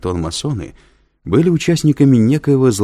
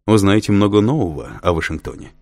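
ordinary delivery

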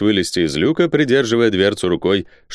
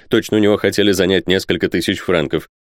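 plain reading voice

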